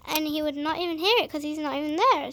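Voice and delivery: usual speaking voice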